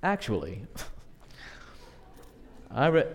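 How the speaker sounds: low, snooty "Actually" with a laugh; mock pedantic know-it-all; know-it-all correction. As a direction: correct them like a smug contrarian. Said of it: "pull off their glasses and go".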